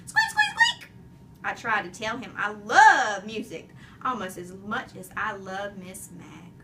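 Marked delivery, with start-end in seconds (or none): 0.1-0.9 hamster squeaks. A narrator's reading. Hamster answers.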